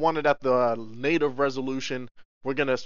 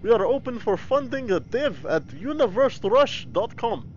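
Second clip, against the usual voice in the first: reading menu text in a mock Russian accent, higher; 9 semitones higher than usual. Shown as a demonstration. Streamer reads game menu for chat in a mock Russian accent. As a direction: menu read as a hammed-up Russian, pitched up. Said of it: "Russian next".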